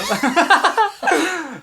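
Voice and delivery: loud high cackle; high burst of laughter. Real, not acted.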